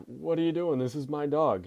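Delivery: high, loud acted protest; indignant protest. Boy confronting the dog catcher over his dog, indignant. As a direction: imitate an upset owner objecting.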